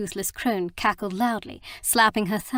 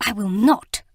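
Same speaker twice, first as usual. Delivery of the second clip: louder, clipped furious refusal; furious hiss; 6 dB louder than usual. Acted. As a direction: spit the refusal at him in fury. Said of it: "Mina hissed back at him furiously".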